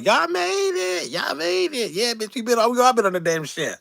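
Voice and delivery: mimicking Veronica greeting them late, high and sing-song; mocking Veronica. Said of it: "that goddamn whistle talking about".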